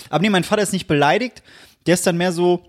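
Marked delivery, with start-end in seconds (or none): none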